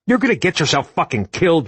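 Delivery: fast, high angry scold. A narrator's reading. Dom scolding Marcus for running into the burning vehicle, fast and angry. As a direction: tear into him like a reckless kid. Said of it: "the usual shaky anger".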